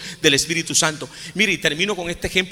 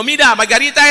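raised call to Margarita; 8 semitones higher, 8 dB louder than usual. Retold or imitated. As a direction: call her over loudly. Priest calling a parish helper.

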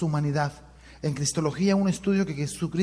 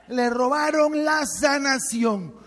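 drawn out slowly, high and loud; slow emphatic preaching; 8 semitones higher, 4 dB louder, much slower than usual. Acted. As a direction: stretch every word for emphasis. Predicador recalca la idea. (lento y enfático, alto y fuerte)

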